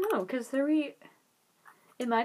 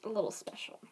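whispered gossip; hushed, secretive aside; whispering; 2 semitones lower, 8 dB quieter than usual. Real, not acted. Gossiping about a teacher.

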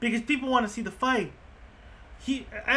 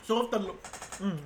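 talking around ice in mouth; 2 semitones lower, 7 dB quieter than usual. Real, not acted. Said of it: "I got ice in my mouth".

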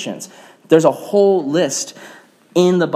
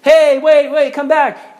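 high, loud mock call from afar; acted pleading holler; 10 semitones higher, 4 dB louder, slower than usual. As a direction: shout after a departing ship, pitched way up. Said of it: "he hears these voices".

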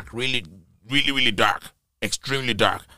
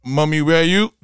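loud, raised questioning call; calling out for mother; 5 semitones higher, 10 dB louder than usual. Retold or imitated. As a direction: call out for mum in the dark.

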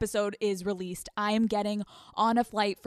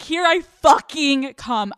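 slow, much louder triumphant yell; excited shout; 5 semitones higher, 9 dB louder, slower than usual. Real, not acted. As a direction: yell it out, giddy and thrilled. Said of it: "I'm so excited".

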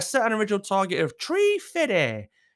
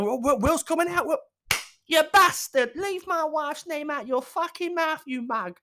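fight announcer and Will; 10 semitones higher than usual. Pretend. Imagining Will Smith in the UFC, mock-angry shouting. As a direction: play a ringside yelling match. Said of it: "And then".

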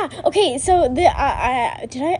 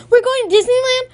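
high, louder hopeful question; eager kids; 10 semitones higher, 6 dB louder than usual. Retold or imitated. Kids hoping for Disneyland, high and eager. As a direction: get your hopes up. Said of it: "Me and Obi".

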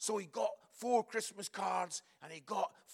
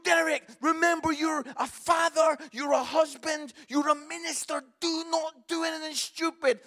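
raised, higher urgent warning to a friend; shouting a warning at a raging friend at a football match; urgent plea over noise; 7 semitones higher, 5 dB louder than usual. Retold or imitated. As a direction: plead loudly with Derek over the crowd. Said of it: "I'm saying to him".

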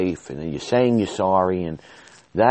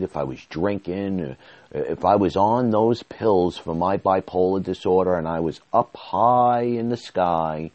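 ordinary talk

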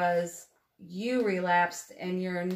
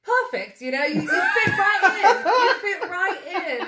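put-on loud, much higher British accent through laughter; imitated British accent; 10 semitones higher, 7 dB louder than usual. British seatmate approves her dialect, imitated British accent, loud and laughing. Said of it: "a guy from the U K".

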